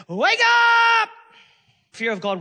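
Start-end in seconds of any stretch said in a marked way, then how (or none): 0.1-1.1 loud, high shout to wake someone; wake-up yell at a sleepy driver; alarm shout. Retold or imitated. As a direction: blast it like a horn. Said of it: "making a noise like".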